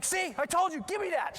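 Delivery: high, accusing gotcha; snatch it away with mock outrage; imitated teacher's triumph. Teacher finding Tootsie Rolls on girl, high imitated accusation. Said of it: "the teacher's like".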